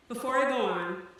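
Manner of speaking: usual speaking voice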